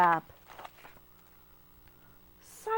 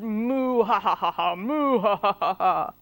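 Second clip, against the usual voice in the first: lower, louder mooing laughter; mocking moo laugh; 4 semitones lower, 4 dB louder than usual. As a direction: moo and laugh at the odd cow. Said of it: "all the other cows said".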